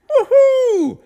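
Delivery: soaring high, loud whoop; jumping off the tower with a whoop; joyful whoop. Acted. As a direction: let out a wild yell as you leap. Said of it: "gav han till ett tjut".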